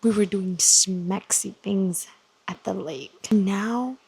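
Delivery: hushed reactions. Real, not acted. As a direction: keep it hushed so nobody wakes. Late-night hushed reading reactions.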